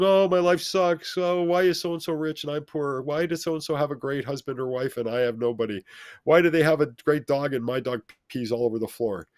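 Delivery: mock a self-pitying whiner; whiny self-pity. Mocking complainers' self-pity.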